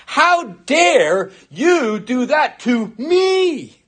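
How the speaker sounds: slow, drawn-out offended demand; stretched, self-righteous how dare you; indignant mock outrage. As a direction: voice the sinner's wounded pride. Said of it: "you think".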